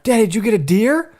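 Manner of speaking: put-on high, childlike little girl asking daddy; little daughter voice. Dad mimics his toddler daughter's eager question on FaceTime. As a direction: play the three-year-old, way up high.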